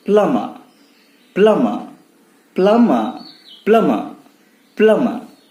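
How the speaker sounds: "प्लम्बर" as PLUM-ma, falling each time; silent B drill. Shown as a demonstration. Teacher drilling the word, silent B. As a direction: drill "प्लम्बर" without B, pausing between repeats. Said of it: "प्लम्बर नहीं".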